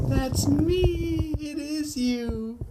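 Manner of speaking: very high, drawn-out mimic of a computer voice; playful high echo. Host echoes the chat's computer voice, high drawn-out mimicry. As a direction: stretch it out high, almost sung.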